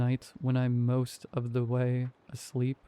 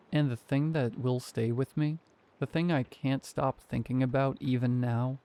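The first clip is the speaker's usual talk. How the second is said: ordinary delivery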